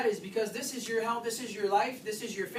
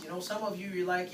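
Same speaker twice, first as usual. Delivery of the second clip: ordinary delivery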